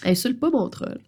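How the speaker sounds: usual speaking voice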